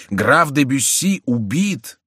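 slow, high exclamation of disbelief; astonished cry. A narrator's reading. Миньоны repeat the news of Бюсси's killing, astonished cry. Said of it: "вскричали трое миньонов".

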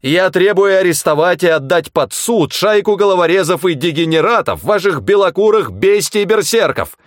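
high, loud hysterical shouting; hysterical yelling. A narrator's reading. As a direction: scream it down the phone on the edge of hysteria. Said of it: "заорал в трубку Тагоми".

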